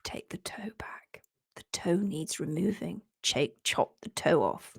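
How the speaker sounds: hushed, far-off whisper of the dreaded advice; whispered quote. Retold or imitated. Host retells the vet's dreaded words in a whisper. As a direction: breathe it like a spooky secret. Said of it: "the immortal words".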